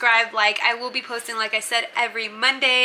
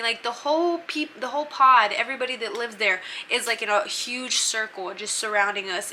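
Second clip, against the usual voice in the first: ordinary talk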